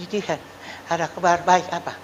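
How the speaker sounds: soft, hoarse, croaking voice like a duck; lost voice. Shown as a demonstration. Pastor shows the congregation his voice when it was gone, softly croaking. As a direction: show the crowd how the sick voice sounded, faint and hoarse. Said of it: "Atau sudah seperti".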